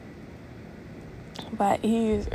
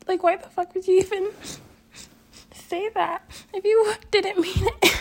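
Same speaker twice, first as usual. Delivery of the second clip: high, crying voice; tearful protest; 9 semitones higher than usual. Real, not acted. Hurt vlogger questioning what the friend texted, crying. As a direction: protest through real tears, pitched way up.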